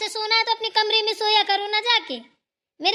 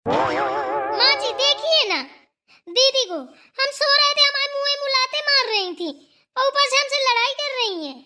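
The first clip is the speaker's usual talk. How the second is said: whiny complaint; 6 semitones higher than usual. Acted. Girl complains to grandma.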